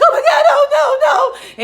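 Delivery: high, loud terrified shriek. Retold or imitated. Emily as the police come up, high terrified shriek. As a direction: wail no in terror. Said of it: "she's like".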